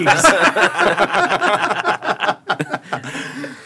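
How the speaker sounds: high, loud, sustained laughter; real extended cackling fit; long laugh. Real, not acted. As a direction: laugh hard and keep going. Cracking up after innuendo.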